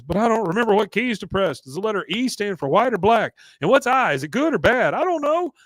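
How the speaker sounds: high, loud mock panic; imitated frantic fluster. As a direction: ask in mounting confusion, voice climbing. Voicing Hank panicking during test.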